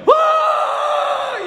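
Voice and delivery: very high, long yelled whoa; shocked whoa. Retold or imitated. Man on the toilet overwhelmed, a huge high whoa. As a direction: let it fly up in shock.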